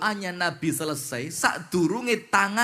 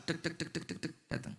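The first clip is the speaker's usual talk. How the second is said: footsteps; 3 semitones lower, 16 dB quieter, faster than usual. Acted. Footsteps imitated.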